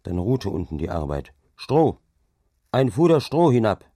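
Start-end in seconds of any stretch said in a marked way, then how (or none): 1.6-3.8 higher, louder call down the line. A narrator's reading. Hauke orders the men above to send straw, calling out. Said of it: "rief er denen droben zu".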